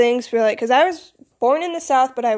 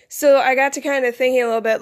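ordinary talk

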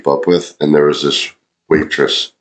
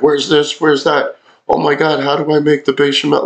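high panicked squeaky voice; mock flustered new server, pitched way up; frantic server; 10 semitones higher than usual. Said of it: "getting screamed at by, like, the chef".

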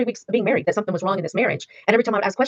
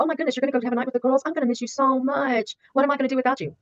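mock clingy pleading; 5 semitones higher than usual. Woman mimicking a manipulative partner before girls' night, mock clingy pleading. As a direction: imitate a partner laying it on thick.

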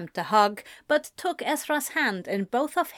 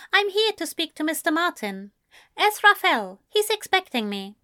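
a hint of a higher, measured gentleman; poised young man insisting politely; Ezra, lightly higher, cool and composed; lightly voiced Ezra; 8 semitones higher than usual. Ezra's voice, polite and firm.

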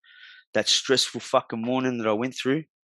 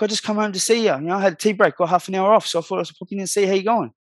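higher, light casual wife voice; imitating his wife; 7 semitones higher than usual. Wife popping home on her tea break, higher cheerful imitation.

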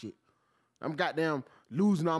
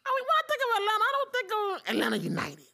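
incredulous high voice. Real, not acted. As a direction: let the voice climb in mock disbelief. Incredulous about soccer.

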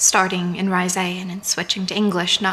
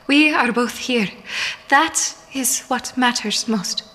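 higher, deliberate, emphatic insistence; firm interruption; 4 semitones higher than usual. A narrator's reading. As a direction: cut in and settle it firmly. She cuts off an apology.

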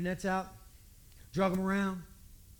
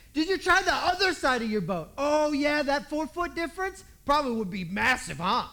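higher, louder sarcastic whine; a fisherman sarcastically mocks the bystander's advice, higher and louder; 6 semitones higher, 7 dB louder than usual. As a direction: ham up the sarcasm. Sarcastic exchange acted.

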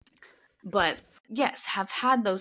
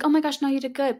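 reassuring teacher; 7 semitones higher, 5 dB louder, faster than usual. Retold or imitated. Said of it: "she's like".

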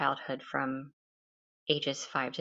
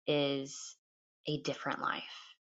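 whisper; 2 semitones lower, 6 dB quieter than usual. Shown as a demonstration. Repeating the key message.

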